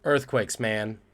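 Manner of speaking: ordinary talk, nothing marked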